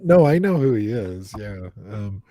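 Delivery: ordinary talk, nothing marked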